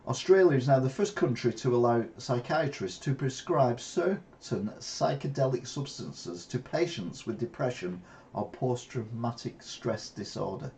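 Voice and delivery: usual speaking voice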